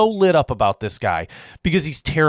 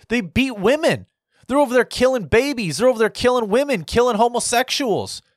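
outraged; 7 semitones higher than usual. Real, not acted. Ranting to viewers about the Taliban, outraged and raised. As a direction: let the outrage lift the pitch, quickly.

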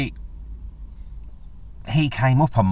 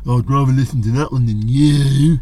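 imagined listener; 3 semitones higher, 6 dB louder than usual. Retold or imitated. Voicing listeners' imagined retort.

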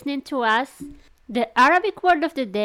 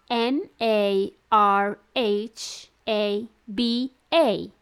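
slow, letter by letter; spelling it out; much slower than usual. Shown as a demonstration. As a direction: spell each letter out slowly. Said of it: "go ahead and spell that for us".

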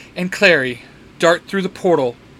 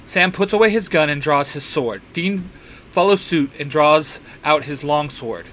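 ordinary delivery, nothing marked

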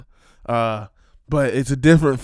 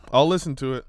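usual speaking voice

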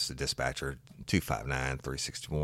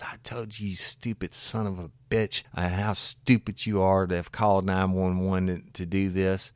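retold wife's scolding; 6 semitones higher, 7 dB louder than usual. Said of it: "wife chimes in and says".

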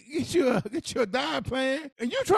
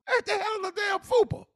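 high-pitched incredulous question; baffled; 11 semitones higher than usual. Real, not acted. Host asks co-host about an unknown word, baffled. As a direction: blurt it out, totally baffled.

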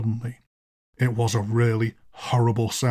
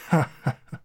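low eerie chuckle; low laugh; 3 semitones higher than usual. Acted. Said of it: "a low laugh was heard".

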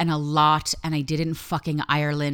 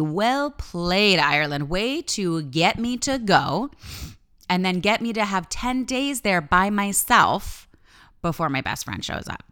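mock toast; 4 semitones higher, 4 dB louder than usual. Conceding to Ireland mockingly.

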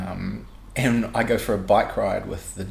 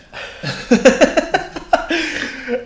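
loud, high burst of laughter; real laughter; 7 dB louder than usual. Real, not acted. Teased about wanting jaywalking enforcement, loud high laughing. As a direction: crack up loudly.

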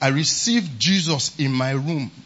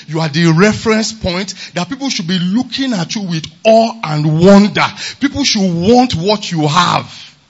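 loud, rising preacher's declaration; full-force preaching; 8 dB louder than usual. Acted. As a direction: proclaim it to the congregation, louder and louder.

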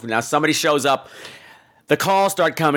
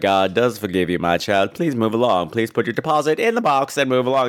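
much lower, dismissive hurry-along voice; bored preacher; 6 semitones lower than usual. Retold or imitated. Radio preacher waving off the confessor, low and dismissive. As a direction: brush her off, low and flat-toned. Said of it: "And Agnes Moorehead's like".

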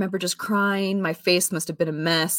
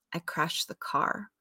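mild retold pain; 8 dB quieter, slower than usual. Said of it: "he sounded like he was in pain".

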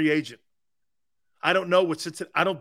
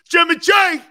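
high, loud call-out of the name; booming hail to the caller; shout; 12 semitones higher, 12 dB louder than usual. Real, not acted. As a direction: yell his name across the studio. Calling out to a caller.

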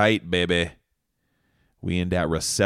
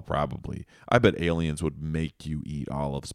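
ordinary talk

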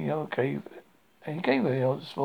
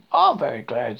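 louder, stressed, pleased agreement; happy; 9 dB louder than usual. A narrator's reading. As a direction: chime in firmly and gladly. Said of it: "said Piglet happily".

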